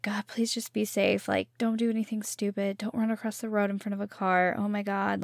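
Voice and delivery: worried mom whispering a prayer; whispered prayer. Retold or imitated. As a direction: pray under your breath for the kids. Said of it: "and I'm like".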